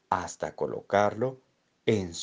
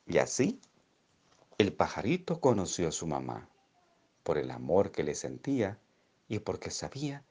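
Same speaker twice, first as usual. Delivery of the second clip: plain reading voice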